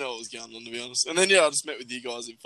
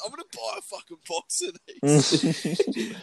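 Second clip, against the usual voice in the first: put-on slow, high laughing drunk vow; mock drunk boast; 5 semitones higher, much slower than usual. Mate imitates drunk Adam's vow, laughing. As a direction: laugh through a drunk promise. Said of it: "And he's like".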